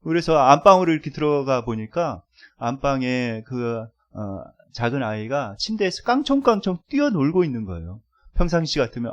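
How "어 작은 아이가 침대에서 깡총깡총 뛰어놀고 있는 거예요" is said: usual speaking voice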